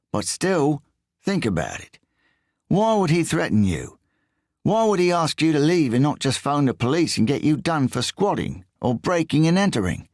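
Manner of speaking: high, whiny English voice; whiny Englishman. A narrator's reading. English thief quizzes Riso in a high whiny voice. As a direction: push it up into a whine.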